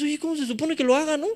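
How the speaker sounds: high, quick exasperated voice; mock annoyed person. Someone annoyed at a clueless learner, mock exasperation. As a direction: act out someone irked you don't know. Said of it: "se enoja contigo".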